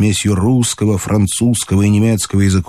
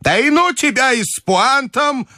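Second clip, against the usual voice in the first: put-on high, testy old lady; irritable high-pitched dismissal; irritated grandmother. A narrator's reading. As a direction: brush him off in a high, cross voice. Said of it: "раздражительно отмахнулась от него бабушка".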